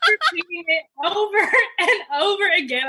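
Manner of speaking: high, laughing through the words; can barely get it out for laughing; real laughter breaking into the line. Real, not acted. Laughing hard at her story.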